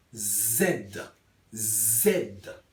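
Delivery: "Z" as a low, buzzed "zed"; Z en criollo. Shown as a demonstration. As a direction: hold a voiced buzz into "Z", zed. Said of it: "una cosa, Z". ("Z" sonora, "zed", con zumbido)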